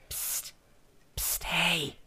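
hushed psst to get attention; sneaky whispered psst, hey. Acted. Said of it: "is she right next to them doing that".

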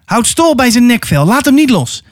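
high-pitched, pressing instruction; urgent order. Acted. Atta tells Okon to hold the wolf, urgent order.

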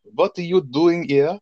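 slow, high-pitched hammed-up German accent; over-the-top German accent. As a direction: badly fake a German mad doctor, drawn out. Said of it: "over-the-top German accent".

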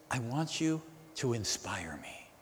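breathy whisper of a longing prayer. Acted. Pastor whispers the congregation's prayer to God. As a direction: whisper it like a private plea to God.

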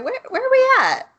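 high-pitched puzzled question; retold confusion, voice jumping way up; mock bewildered question. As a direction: comically ask where on earth this is. Retells own confusion after pausing.

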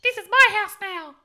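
put-on high, squeaky boy taunting; squeaky teen voice. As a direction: squeak it like a cocky sixteen-year-old. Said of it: "fucking squeaky voice".

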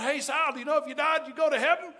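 excited question. Retold or imitated. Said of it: "I said".

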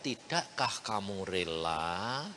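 low, slow and soft, like a quiet divine rebuke; slow hushed divine question; gentle voice of God. Retold or imitated. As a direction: let the words fall slowly and softly. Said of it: "Aung dia ngomong".

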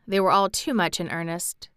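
usual speaking voice

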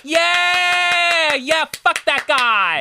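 high, slow whooping crowd voice; mock cheering crowd. Imitating the studio audience, a high slow cheering crowd. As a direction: squeal it like a cheering mob.

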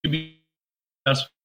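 choppy, glitchy call audio; breaking up like a bad connection. Real, not acted. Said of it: "the audio is breaking up a bit".

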